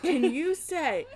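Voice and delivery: slow, high, laughing British put-on; mock British accent. Mother coaching her son in a mock British accent. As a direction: drawl it in a bad British accent. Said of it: "in my awful accent".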